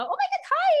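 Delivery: high delighted greeting to a pet; excited squeal. Real, not acted. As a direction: squeal hello at the puppy. The dog walks in.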